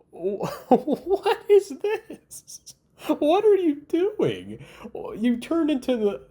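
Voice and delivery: can't stop laughing at how absurd it is; squeaky high laughing incredulity; laughing disbelief. Real, not acted.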